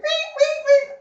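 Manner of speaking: very high squealing imitation of a horror movie screech; shrill movie stab sound, imitated; mock horror screech. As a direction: squeal it like a slasher film violin. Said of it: "scary movie and it's like".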